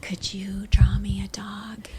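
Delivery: put-on soft slow child's whisper. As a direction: whisper it like a kid in church. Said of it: "and I was like".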